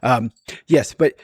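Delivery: usual speaking voice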